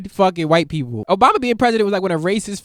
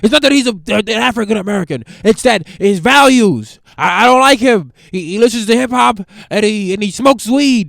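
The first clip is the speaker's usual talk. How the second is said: huffy dad; 3 semitones higher, 7 dB louder than usual. Acted. Dad explains objections.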